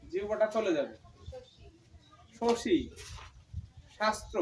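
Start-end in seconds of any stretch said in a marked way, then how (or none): 2.4-4.4 শশী, শাস্ত্র with tongue fully curled back; retroflex sh demo. Shown as a demonstration. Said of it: "জিহ্বাটা উল্টে যাচ্ছে একদম". (জিভ উল্টে উচ্চারণ)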